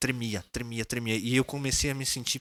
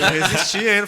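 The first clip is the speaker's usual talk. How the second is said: much higher and louder through a laugh; 8 semitones higher, 11 dB louder than usual. Real, not acted. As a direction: laugh it out, pitched way up. Laughing while retelling resisting.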